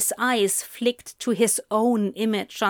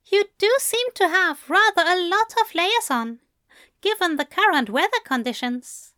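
higher, gentle English gentleman; Aziraphale voice; 8 semitones higher, faster than usual. A narrator's reading. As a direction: lift the voice for the fussy angel.

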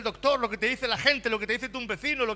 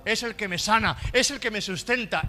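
lectura bíblica aguda y enérgica; predicación a plena voz; 3 semitones higher, 6 dB louder than usual. Acted. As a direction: proclama el versículo recalcando palabras. Preaches scripture at full force. (prédica fuerte, con pausas enfáticas)

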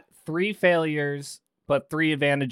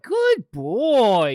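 put-on high, louder baby talk to a cat; pet praise; 10 semitones higher, 6 dB louder than usual. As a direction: gush over the cat. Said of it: "I'm just like".

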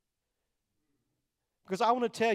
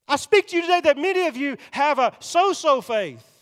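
loud, soaring preacher cry, much higher; preaching at full force; 8 semitones higher, 11 dB louder than usual. Acted. Preacher pleading with the congregation about a lost young man, in a loud soaring cry. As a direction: let it ring out like a revival sermon.

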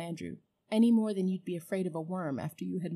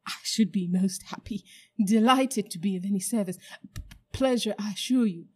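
louder, eager, stuttering P-pleasure; nervous fawning; 6 dB louder than usual. Acted. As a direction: grovel with shaky eagerness. Uncle Andrew fawning.

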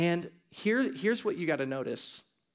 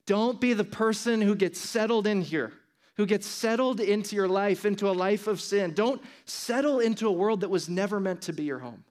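raised, higher preaching push; street preacher; 5 semitones higher, 3 dB louder than usual. Real, not acted. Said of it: "if I sound like a crazy street preacher".

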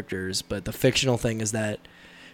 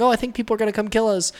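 high, quick mock-worried voice; movie heroine; 9 semitones higher, faster than usual. Telling how the film ended with her, high quick mimicry. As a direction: mimic the heroine in a raised, hurried voice. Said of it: "it ended with her, like, going".

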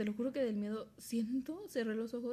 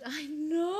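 a sudden, louder, higher yelp; mock alarm; 6 semitones higher, 7 dB louder than usual. Telling her friend about sleep paralysis, mock alarmed yelp. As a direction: relive the shock in a yelp. Reliving the scary moment.

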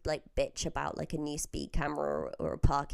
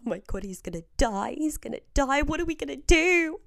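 freaking out; 7 semitones higher, 4 dB louder than usual. Retold or imitated. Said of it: "we were freaking out".

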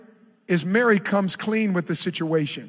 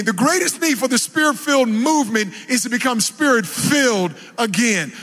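ordinary delivery

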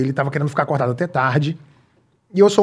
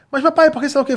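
put-on much higher, whiny little kid; child's voice; 10 semitones higher, faster than usual. Son nagging dad during the match, kid voice. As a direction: pester like a small child.

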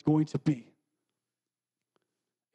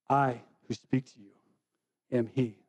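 solemn Jesus quote; 8 semitones lower than usual. Acted. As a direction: let it land gently, a touch reverent.

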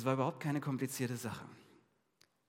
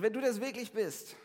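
urgent call; 8 semitones higher, 5 dB louder than usual. A narrator's reading. Peter calling to Jesus in storm.

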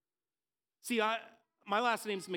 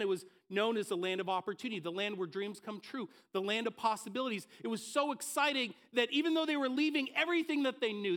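ordinary talk, nothing marked

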